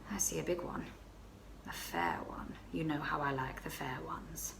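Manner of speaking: breathy hushed storytelling; whispered confession. Acted. Baroness whispering the flirtation to her friend. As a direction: whisper it as if someone might overhear.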